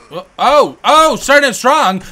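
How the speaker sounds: loud, high burst of glee as the fight opens; excited shout. Real, not acted. Gamer hollering at the screen as his boxer lands early, loud and thrilled. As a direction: yell it out, thrilled at a strong start.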